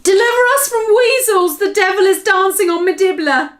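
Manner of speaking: high, loud near-sobbing wail; anguished cry. Acted. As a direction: plead in despair. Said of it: "His voice was filled with fury and anguish".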